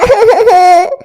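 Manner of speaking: crying sob; tearful sob. Acted. Girl sobs.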